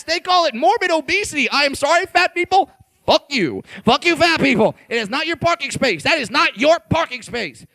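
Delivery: normal talking voice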